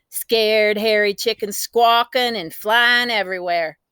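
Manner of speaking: plain reading voice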